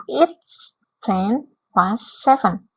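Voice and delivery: very slow, careful English answer; slow model answer. Shown as a demonstration. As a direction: say it word by word for the class. (dibaca sangat pelan sebagai contoh)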